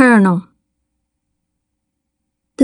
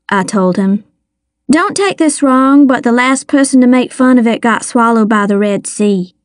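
usual speaking voice

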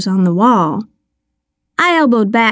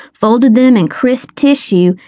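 plain reading voice

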